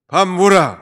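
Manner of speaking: put-on a blunt Gyeongsang accent telling someone to eat; rough Gyeongsang accent. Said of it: "경상도는 너무 또 과격해".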